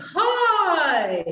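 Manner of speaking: long loud "hi", swooping up and gliding down; lifted soft palate. Shown as a demonstration. Coach repeating the palate exercise for choir, lifted and loud. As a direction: lift the palate and call it out slowly. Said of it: "Raising the soft palate".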